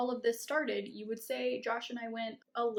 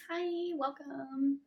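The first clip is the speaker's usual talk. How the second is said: high, slow, sugary greeting; customer service voice; 6 semitones higher, much slower than usual. Shown as a demonstration. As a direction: put on the bright service-desk voice. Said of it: "Should I turn on my customer service voice?".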